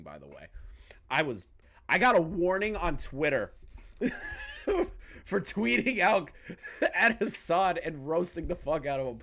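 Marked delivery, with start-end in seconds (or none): 4.0-9.2 talking through giggles, higher at first; laughing. Real, not acted. Streamer admitting a warning for roasting someone, laughing. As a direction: crack up while confessing. Amused confession of Twitter warning.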